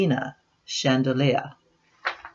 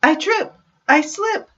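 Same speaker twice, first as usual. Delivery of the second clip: much higher, louder tumbling panic; comic mishap; 4 dB louder than usual. Acted. Nancy dropping the parfait tray, high louder comic yelp. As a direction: yelp it as everything falls.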